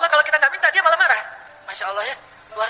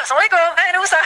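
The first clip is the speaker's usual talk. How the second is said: higher, bright eager greeting; cheerful greeting; 6 semitones higher, faster than usual. Retold or imitated. Mother returns to Nabi Musa with her baby, cheerful. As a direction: burst in cheerful with good news. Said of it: "udah semangat sekarang".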